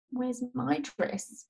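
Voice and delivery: put-on high, soft, drawn-out little-girl question; slow plaintive imitation of a small child; voiced young daughter. Mother imitating her four-year-old asking after a dress, childlike whine.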